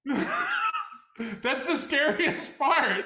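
high, squeaky laughing speech; can barely get it out for laughing; laughing hard. Real, not acted. Laughing at his own bit.

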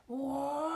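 very high, sustained wail. Acted. A lost seal alone on the ice, high wail. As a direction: hold a long, high cry. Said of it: "froze at the wailing sound".

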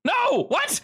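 high, startled man's voice; a jittery prisoner caught off guard; nervous trapped man. Acted. GM voices Rick.

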